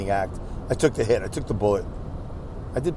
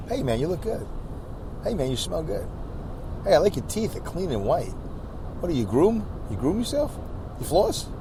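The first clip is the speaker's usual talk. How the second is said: softer, easy friendly compliments; soft casual compliments; 4 semitones higher, 8 dB quieter than usual. Retold or imitated. Showing friends how to compliment him, soft and casual. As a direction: toss off easy nice compliments quietly.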